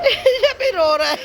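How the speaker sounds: laughing through the words; can't stop giggling as she points. Real, not acted. To the camera about the crying kids, laughing.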